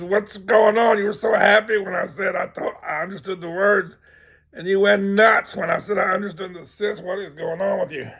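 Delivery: loud, high-pitched demand for an explanation; agitated bewilderment. Acted. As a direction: confront her, baffled and worked up. Acted quote, bewildered man confronts woman.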